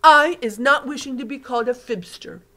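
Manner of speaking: loud, offended giant voice; sharp. Acted. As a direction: cut the words short in hurt. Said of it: "said the B F G sharply".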